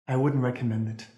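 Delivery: polite, understated advice. Acted. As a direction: say it with mild, measured restraint. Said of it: "a more polite way of saying it".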